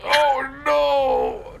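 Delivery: high, loud cry of dismay; shocked shout. Real, not acted. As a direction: yell out in horror at the roll. Ally rolled natural one.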